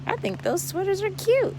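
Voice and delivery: high, fast, squeaky cheeky aside. Acted. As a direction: pop in as a cheeky little character. Aside in a put-on voice.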